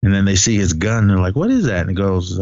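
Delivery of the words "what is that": high, puzzled mock question; retold curious surprise. Hunters spotting a stranger's gun, mock surprise. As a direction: act out a hunter's startled curiosity.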